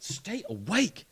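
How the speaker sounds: high, drawn-out mock urging; urgent nudge. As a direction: stretch it out to rouse someone. Acting a friend nudging him awake.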